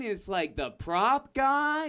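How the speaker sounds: ordinary talk, nothing marked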